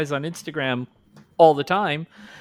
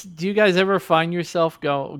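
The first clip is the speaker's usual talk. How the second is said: ordinary talk, nothing marked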